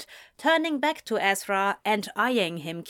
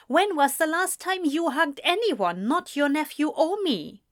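higher, playful comeback; snippy without bite; 8 semitones higher than usual. A narrator's reading. Eliza answering her brother's teasing, snippy but playful. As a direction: snip back, but harmlessly. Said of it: "snipped back with no bite".

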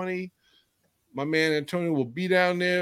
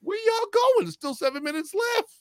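high-pitched teasing at leaving fans; mock taunt; 9 semitones higher, 3 dB quieter than usual. Host retelling how he taunted departing Rams fans, high and mocking. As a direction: needle them as they walk out. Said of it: "I said".

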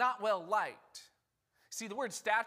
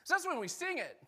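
ordinary talk, nothing marked

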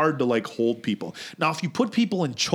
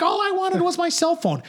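put-on high whining girlfriend voice; whiny girlfriend; 12 semitones higher than usual. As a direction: whine it like she just wants her phone. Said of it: "She's like".

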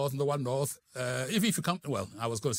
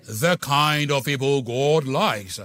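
slow, drawn-out posh Burkina Faso accent, louder; 8 dB louder, much slower than usual. Shown as a demonstration. Preacher to congregation, slow mock posh Burkina Faso accent. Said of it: "A posh accent from Burkina Faso".

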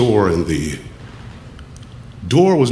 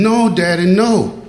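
higher, pleading cry; pleading boy; 8 semitones higher, slower than usual. Retold or imitated. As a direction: beg daddy to stop. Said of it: "The boy kept saying".

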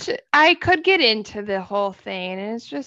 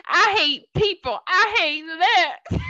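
high, drawn-out mock complaint; mock people-hater; 7 semitones higher, slower than usual. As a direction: imitate a whiny hater, pitched up. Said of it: "You say".

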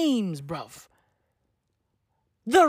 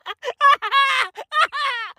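wheezing cackle; 13 semitones higher than usual. Real, not acted. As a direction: break down laughing. Bursting laugh.

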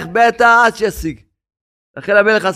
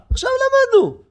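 high mock refrain. Preacher mocking the idea, high mock voice. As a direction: leap very high on עכשיו למדנו.

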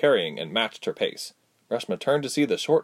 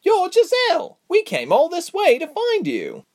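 very high, excited exclamation; thrilled, astonished squeal of recognition; surprised; 5 dB louder than usual. A narrator's reading. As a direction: blurt it in high-pitched astonishment. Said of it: "looked surprised again and said".

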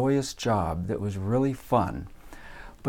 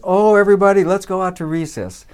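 loud, higher burst of relief, easing off; relieved teacher; 6 semitones higher, 8 dB louder than usual. Retold or imitated. Relieved teacher sends everybody to recess, acted relief. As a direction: imitate a teacher rejoicing at being let off. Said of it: "the teacher was so relieved".

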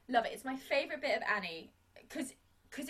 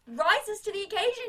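normal talking voice

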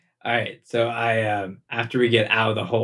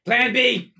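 high, urgent-sounding shout; calling out; 12 semitones higher than usual. Acted. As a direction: yell it out high like a signal. Player shouts backup plan in game.

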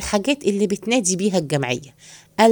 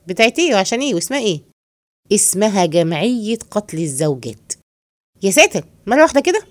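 ordinary talk

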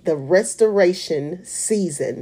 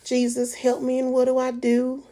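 crying prayer; 7 semitones higher than usual. Retold or imitated. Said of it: "crying and snotting".

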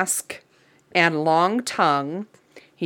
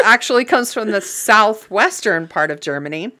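usual speaking voice

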